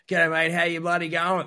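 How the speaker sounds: lightly hammed-up broad Australian greeting; put-on ocker Australian. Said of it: "you just put it on".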